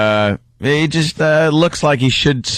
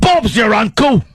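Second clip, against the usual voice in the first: put-on high English-accented holler; mock English send-off; 6 semitones higher than usual. Co-host seeing the intern off in a high mock-English shout. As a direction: cry it out like a cartoon Englishman, accent thick.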